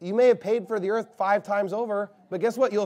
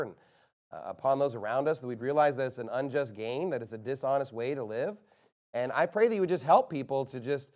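subdued, earnest spoken prayer; closing prayer; 3 semitones lower, 4 dB quieter than usual. Real, not acted. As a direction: pray aloud for the congregation, restrained and running on.

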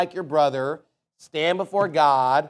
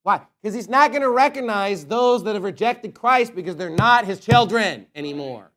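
ordinary talk, nothing marked

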